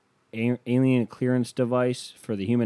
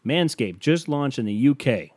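normal talking voice

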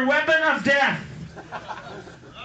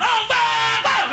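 panicked pilot yell; 11 semitones higher, much slower than usual. Retold or imitated. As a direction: yell like a doomed man seeing the bomb. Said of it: "sticking his head out the window going".

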